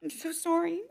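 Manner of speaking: tearful apology, high and soft; crying while apologizing. Real, not acted. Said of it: "I didn't think I'd be the one crying".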